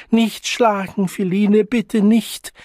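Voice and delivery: high, loud begging; frightened pleading. A narrator's reading. Child shielding his face from his mother's blows, pleading in fear. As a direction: plead urgently to be spared.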